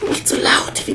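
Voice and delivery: hushed, breathy plea; embarrassed whisper. Acted. Justus whispering to his aunt, embarrassed in pajamas. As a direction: whisper to keep it down.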